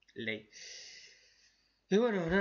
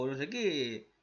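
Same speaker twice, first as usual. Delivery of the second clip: ordinary delivery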